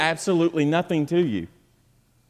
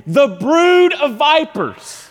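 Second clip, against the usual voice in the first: high, slow preached outburst; sudden denunciation; 12 semitones higher, 8 dB louder, slower than usual. Acted. Preacher shows calm talk switching to name-calling, sudden denunciation. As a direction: switch abruptly into a drawn-out rebuke. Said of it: "all sudden they switch to calling you".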